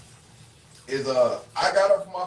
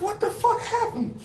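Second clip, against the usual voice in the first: much higher, strained what the fuck happened; squeezed high with disbelief; retold disbelief. Man retelling his fall to a friend, high disbelief. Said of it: "I said".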